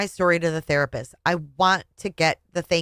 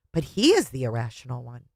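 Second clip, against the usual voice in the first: ordinary delivery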